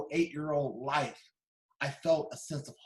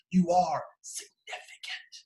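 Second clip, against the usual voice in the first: remembered voice; slower than usual. Retold or imitated. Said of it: "I would hear her voice".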